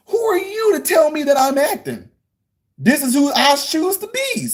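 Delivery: high-pitched, hammed-up indignant shout; theatrical affronted outburst; mock outrage. Acting outrage at being told.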